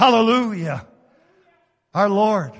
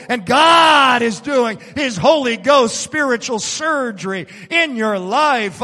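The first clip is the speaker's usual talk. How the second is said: louder, driving preacher's cry; preacher's shout; 4 semitones higher, 6 dB louder than usual. Acted. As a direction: belt it to the congregation.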